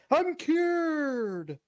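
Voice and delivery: very high-pitched, drawn-out mock proclamation; joyful declaration. Pretending to be cured before everybody, high hammed-up declaration. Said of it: "I'll declare to everybody".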